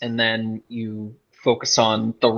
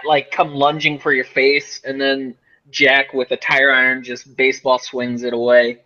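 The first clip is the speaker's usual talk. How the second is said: ordinary talk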